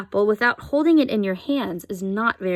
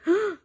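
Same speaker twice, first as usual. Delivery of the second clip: sharp gasp; 10 semitones higher than usual. A narrator's reading. Girl shocked by quacking.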